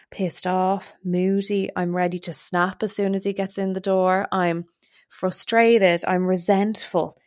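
ordinary talk

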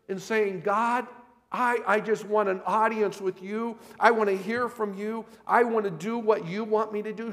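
loud, high-pitched, insistent preaching; fervent preaching. Real, not acted. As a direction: press each phrase on the congregation, rising into pleading prayer.

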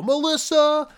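high, loud call of the name; imitated yell. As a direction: yell it out as Brody, drawn out. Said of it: "You hear Brody yell".

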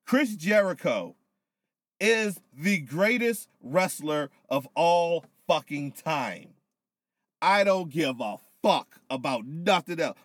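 hyped praise. Real, not acted. Tipsy fan hyping his favourite.

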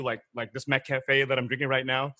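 usual speaking voice

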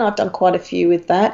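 usual speaking voice

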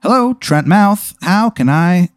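chirpy ghost exterminator answering the call; ad guy on the phone. Acted. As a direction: answer like a too-cheery TV salesman. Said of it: "The person's like".

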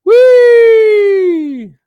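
very high, very loud gleeful whee; party whoop. Pretend. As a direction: shriek it like a giddy partygoer. Mock party cheer in Trudeau skit.

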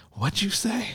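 high, quiet startled question; startled what. Retold or imitated. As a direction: react like you misheard. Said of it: "I just kind of feel myself like".

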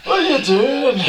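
very high-pitched Scottish comic impression; hammed-up Scottish squawk; Billy Connolly. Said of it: "Billy Connolly".

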